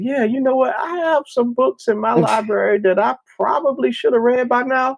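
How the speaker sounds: high mock voice of a guilty book owner; viewer's inner voice. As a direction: squeak it out as a sheepish reader. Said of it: "and you're saying".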